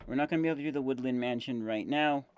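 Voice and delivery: talking while chewing gum. Real, not acted. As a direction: gum in the mouth as you talk. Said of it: "if you hear chewing noises".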